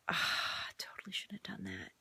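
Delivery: a tired groan of regret after reading all night; regretful whisper. Retold or imitated. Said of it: "you're like".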